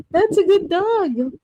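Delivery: doting pet-owner coo; praising the dog. Real, not acted. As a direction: stretch it out sweetly for the dog.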